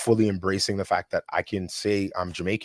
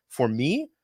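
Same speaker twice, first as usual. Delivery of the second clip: ordinary talk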